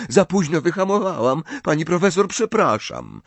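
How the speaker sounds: high, airy non-apology; carefree. A narrator's reading. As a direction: toss off the apology lightly. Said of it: "jak Ginny mówi beztrosko".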